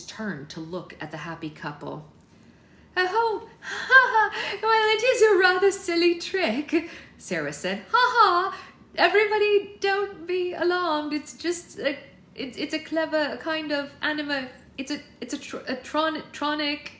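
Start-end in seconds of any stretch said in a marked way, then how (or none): 3.0-6.9 loud, high-pitched forced laugh into speech; nervous laughing bluster. A narrator's reading. Sarah nervously laughing off danger. 7.9-16.9 loud, high forced laugh turning halting; high nervous bluster. A narrator's reading. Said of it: "Her voice abruptly rose in a shriek".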